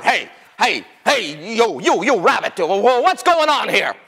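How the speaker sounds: blustering, repeated calls; a hint of a confused bully calling out; lightly voiced Tiger. Tiger running up to Rabbit, mildly blustering. Tiger runs up demanding answers.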